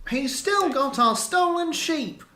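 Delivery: high, slow reedy crier; drawn-out, high reedy complaint; thin reedy voice. Acted. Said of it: "his reedy voice".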